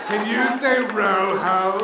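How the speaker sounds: slow over-careful teacher voice; Spanish teacher. Retold or imitated. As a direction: drill the class like an American teacher. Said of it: "that would be the Spanish teacher".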